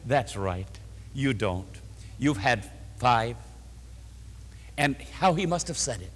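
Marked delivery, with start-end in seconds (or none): 0.1-3.4 kindly. A narrator's reading. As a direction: lower and softer, gentle and kind. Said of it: "And very kindly".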